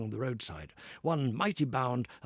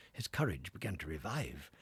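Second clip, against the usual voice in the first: usual speaking voice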